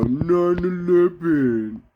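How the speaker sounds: drawn-out high mock announcement; mock warning voice. Mocking the warning label on his video. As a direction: sneer out the warning label slowly.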